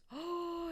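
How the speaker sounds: high gasp of delight. Real, not acted. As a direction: gasp as if it's exactly right. Delighted at finding display shelves.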